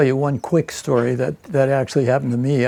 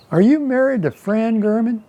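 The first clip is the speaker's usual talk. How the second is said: high, put-on Southern lady voice; little old Southern lady; 8 semitones higher than usual. Southern lady quizzing a fellow volunteer about his marriage, high old-lady voice. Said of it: "a little old Southern lady".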